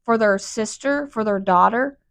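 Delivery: ordinary talk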